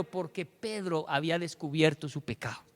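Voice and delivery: usual speaking voice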